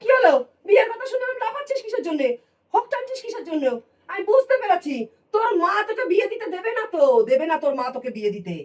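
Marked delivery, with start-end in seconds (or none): 0.0-6.5 teasing surprise. Acted. Aunt teasing nephew about marriage.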